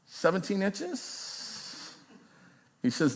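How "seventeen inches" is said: slow, much softer, unsure; sheepish guess. Retold or imitated. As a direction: barely dare to say it, drawn out. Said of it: "someone sheepishly yelled".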